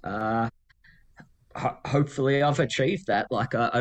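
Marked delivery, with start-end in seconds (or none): none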